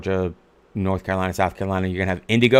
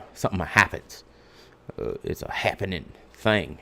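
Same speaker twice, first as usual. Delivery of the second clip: put-on low-key, softer Southern accent; 3 semitones lower, 9 dB quieter than usual. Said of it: "in a Southern accent".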